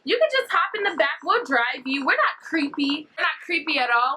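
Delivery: high, coaxing imitation of the girl; pretend-friendly stranger. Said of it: "tries to seem as if she's friends".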